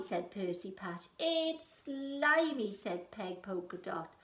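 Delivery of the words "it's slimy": pirate complaint. Acted. Pirate complains about food.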